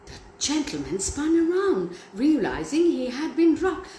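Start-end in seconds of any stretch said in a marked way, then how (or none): none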